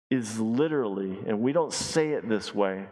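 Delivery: usual speaking voice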